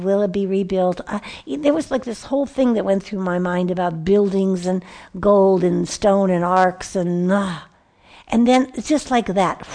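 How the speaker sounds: ordinary talk, nothing marked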